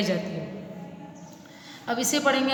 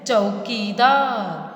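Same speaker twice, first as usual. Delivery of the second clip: slow drawn-out reading; 3 semitones lower, much slower than usual. Shown as a demonstration. Teacher slowly sounding out the word for pupils. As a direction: stretch चौकीदार very slowly, each syllable held. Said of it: "पूरा पढ़ेंगे एक साथ".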